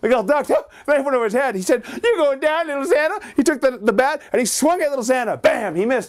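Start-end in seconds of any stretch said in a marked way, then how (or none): 2.0-3.2 put-on high boyish threat; high childish taunt; mock threat. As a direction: taunt the doll with a high kid voice. Boy threatens the doll.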